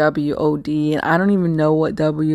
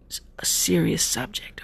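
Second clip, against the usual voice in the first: hushed, softer build-up; mock-serious whisper; 2 semitones higher, 5 dB quieter than usual. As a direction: whisper it as if confiding. Mock build-up to a taboo topic.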